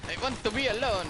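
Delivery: high, loud Garbo-style plea; dramatic diva voice. Pretend. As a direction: ham up wanting solitude. Echoes diva line.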